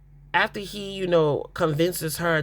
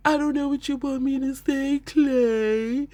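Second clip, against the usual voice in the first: mock crying; 8 semitones higher than usual. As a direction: imitate her tearful wail, hammed-up. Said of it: "And she starts crying".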